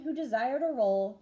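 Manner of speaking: ordinary delivery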